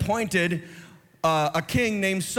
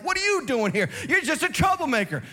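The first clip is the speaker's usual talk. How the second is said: mocking big brother, pitched much higher; scornful brother; 8 semitones higher than usual. Elder brother scorning David at the camp, mock high sneer. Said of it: "the brother's like".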